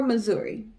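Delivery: ordinary talk, nothing marked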